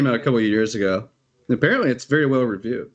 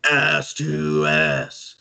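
slow, drawn-out mock chant; mock old-man yelling; 5 semitones higher, much slower than usual. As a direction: imitate leering old men yelling, stretched out. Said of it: "in, like, a dark lit room yelling".

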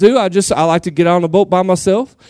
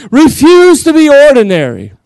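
slow, higher, louder "Refuse to be ordinary" building to a climax; louder preaching; 7 semitones higher, 6 dB louder than usual. Real, not acted. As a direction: raise it up and hit "Refuse" hard. Preacher repeats line louder.

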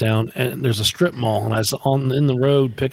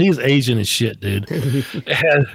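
ordinary talk, nothing marked

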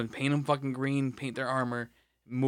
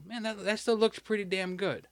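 higher-pitched, impressed remark; impressed onlooker; 7 semitones higher than usual. Retold or imitated. Onlooker picking up a painted figure, impressed. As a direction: voice an admirer, pitched well up.

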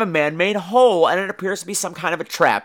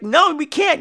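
refusing players; 10 semitones higher, slower than usual. Retold or imitated. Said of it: "And they're like".

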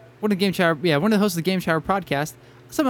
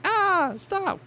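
high-pitched mock whine. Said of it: "I'm like".

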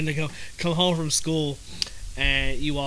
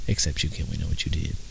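low teasing; 11 semitones lower than usual. Acted. Teasing Kim about secret.

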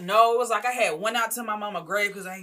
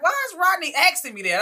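pitched way up, puzzled; high confused voice; 12 semitones higher, 6 dB louder than usual. Retold or imitated. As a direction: ask it high and baffled. Retold own confused thought.

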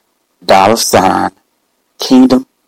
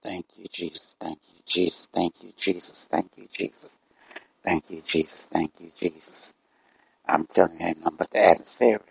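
murmured prayer; 2 semitones lower, 10 dB quieter than usual. Real, not acted. Murmured praise between broadcast talk.

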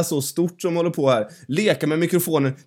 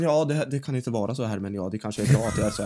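mock timid voice; 5 semitones lower, 6 dB quieter, faster than usual. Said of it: "Istället för att".

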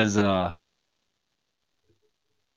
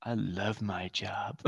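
slow, breathless whispered lie; mock whisper; 5 semitones lower, 16 dB quieter, slower than usual. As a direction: whisper it like a guilty secret. Mock reply to boss.

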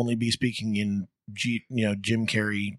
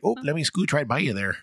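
playful, higher Midwestern voice; Midwestern accent; 5 semitones higher than usual. Acted. Co-host showing their inner Midwesterner, mock Midwestern accent. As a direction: put on a folksy Midwestern accent. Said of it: "the Midwesterner in me comes out".

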